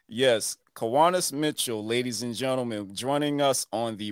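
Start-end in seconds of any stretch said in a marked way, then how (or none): none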